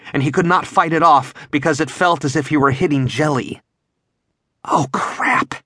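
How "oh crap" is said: breathless frightened whisper. A narrator's reading. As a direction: whisper it in fear. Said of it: "Jeff whispered".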